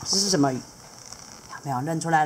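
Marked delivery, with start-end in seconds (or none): none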